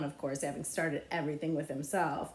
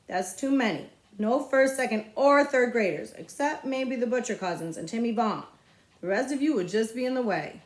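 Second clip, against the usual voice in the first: put-on louder, higher bossy kid; bossy boy; 4 semitones higher, 5 dB louder than usual. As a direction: boss the little ones around. Bossy boy organizing race.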